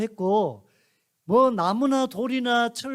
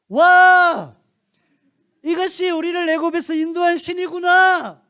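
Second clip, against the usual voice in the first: high, loud excited cheer; people shouting with joy; 9 semitones higher, 11 dB louder, slower than usual. Acted. The people cheer before the golden calf, high loud excited cheering. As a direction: cheer as if the idol saved you. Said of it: "백성들도 소리칩니다".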